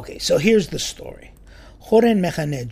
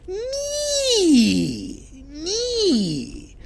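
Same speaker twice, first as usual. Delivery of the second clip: high whining Me, me, much higher and a bit louder; whiny kid; 3 dB louder than usual. Retold or imitated. Mimicking a child whining don't touch. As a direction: whine like a kid about to be touched. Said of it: "The person goes".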